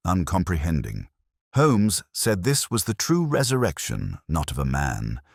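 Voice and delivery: ordinary delivery, nothing marked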